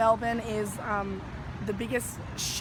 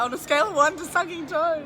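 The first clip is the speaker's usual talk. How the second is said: a touch louder, laughing quote; mock exclaim; 5 semitones higher, 6 dB louder than usual. Retelling a friend's joke, a touch louder and laughing. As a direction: toss out "scale" with a bright lift. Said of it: "And then she was like".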